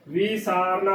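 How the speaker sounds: ਵੀ ਸਾਰਨਾ opening on "vee"; wrong long-i reading. Shown as a demonstration. Teacher showing the wrong reading, ਵੀ ਸਾਰਨਾ with long "vee". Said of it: "ਜੋ ਕਿ ਗਲਤ ਉਚਾਰਨ ਹੈ".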